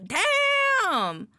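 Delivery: high loud burst of delight; excited shout. Real, not acted. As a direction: shout it out, thrilled. Excited over dessert list.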